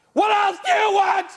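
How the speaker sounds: high piercing shout at God; full-throated cry upward; loud scream. Retold or imitated. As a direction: scream it as loud as she did. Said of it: "it was this loud".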